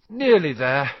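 out of breath hauling the crate; panting. A narrator's reading. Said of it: "Harry panted".

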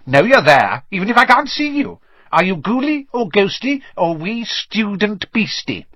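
high-pitched mischievous imp, taunting; Peeves, wicked poltergeist. A narrator's reading. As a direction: needle hidden intruders in a high, impish voice.

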